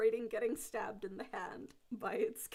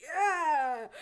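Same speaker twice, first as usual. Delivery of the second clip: put-on drunken cheer; 7 semitones higher, 10 dB louder than usual. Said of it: "turns to you and goes".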